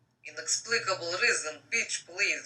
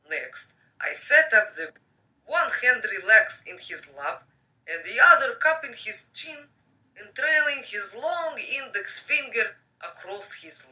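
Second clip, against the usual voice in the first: reading a novel aloud; story narration; 5 dB louder than usual. Acted.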